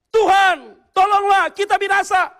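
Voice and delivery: high, loud cry for help; imitated panicked disciples yelling; desperate shouting. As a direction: shout as if about to perish. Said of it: "mereka teriak".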